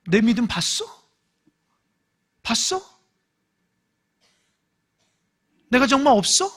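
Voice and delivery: indignant mock comeback, rising on 봤어; bristling at a rebuke of one's faith; mock offended retort. Shown as a demonstration. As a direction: take offence, half-hammed. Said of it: "화부터 낸다고요".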